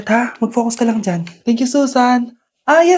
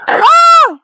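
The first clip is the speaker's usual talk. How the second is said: sudden high, loud shriek of horror; scream; 13 semitones higher, 7 dB louder than usual. Acted. Mother shrieks on seeing the snake, high and loud. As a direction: shriek at the sight.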